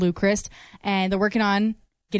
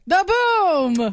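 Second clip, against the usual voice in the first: louder, higher boom; dramatic boom; 8 semitones higher, 4 dB louder than usual. Shown as a demonstration. Co-host demonstrating the announcer's boom, louder. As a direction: punch out the boom. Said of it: "how does he do it?".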